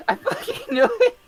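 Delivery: laughing. Real, not acted. Still gloating, laughing.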